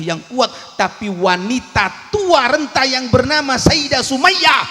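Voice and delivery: preacher roaring the climax of the story; preaching at full force. Acted. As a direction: drive the martyr's story home.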